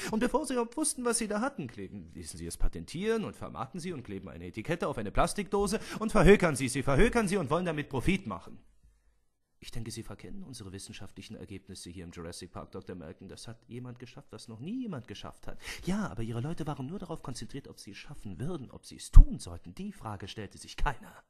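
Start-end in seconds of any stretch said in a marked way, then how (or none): 0.1-8.3 building to a loud angry outburst; rising anger. Acted. Malcolm wettert gegen Hammond. 18.7-19.7 tun hit hard and loud; stress on tun. Acted. Malcolm betont seinen Punkt.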